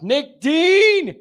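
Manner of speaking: calling out a caller. Real, not acted. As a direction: call the next guest up with gusto. Host calls next caller on air.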